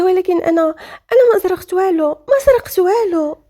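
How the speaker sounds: high-pitched, insistent denial; distressed protest. Acted. Accused woman protests her innocence. (احتجاج متوسل بنبرة مرتفعة)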